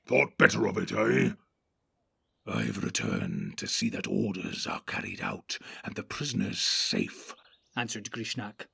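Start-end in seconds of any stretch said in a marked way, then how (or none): 0.0-1.3 gruff orc chief giving orders; Ugluk the orc captain. Acted. Ugluk mocks Grishnak. 2.5-7.3 much lower, hissing orc voice; a low menacing creature, scheming; sly orc rasp, far lower; Grishnak the sly orc. Acted. Grishnak answers.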